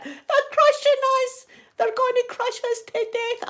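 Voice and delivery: high, hammed-up cries of terror; mock panicked shouting. Surrounded by cows in the car, hammed-up high panic. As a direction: shriek it like the cows will crush you. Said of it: "I'm shouting".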